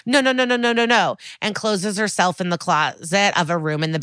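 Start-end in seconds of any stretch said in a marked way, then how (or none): 0.0-1.2 high, repeated panicky no's; put-on high-pitched distress; mock distressed cry. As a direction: imitate someone fleeing in tears, pitched up. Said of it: "She cries and runs down the stairs".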